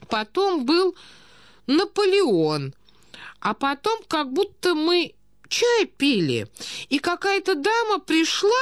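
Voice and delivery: put-on high, slow, doddering old prince; befuddled old prince. A narrator's reading. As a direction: plead feebly in a high-pitched old man's voice. (старый князь, высокий растерянный голос)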